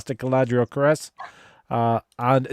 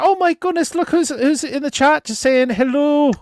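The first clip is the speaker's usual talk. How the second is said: excited; 12 semitones higher than usual. Real, not acted. Excited greeting of chat guest.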